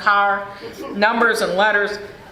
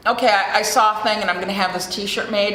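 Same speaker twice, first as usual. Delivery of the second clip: ordinary talk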